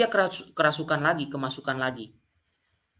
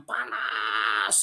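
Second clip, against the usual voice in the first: long drawn-out, lower mock yell; mock scream; 4 semitones lower than usual. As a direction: imitate a spirit's final cry without real volume. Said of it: "dengan teriakan yang sangat kencang". (teriakan tiruan yang dipanjangkan)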